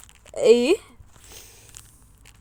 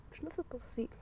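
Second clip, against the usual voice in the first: much softer murmur; hushed; 23 dB quieter, much faster than usual. Real, not acted. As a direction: say it almost to yourself. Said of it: "repeated, first hushed".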